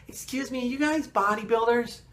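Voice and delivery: imitating a syrupy lady, slowed and lifted; sweet lady stranger. Saleswoman approaching the bodybuilders' table, sugary high imitation. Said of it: "and she's like".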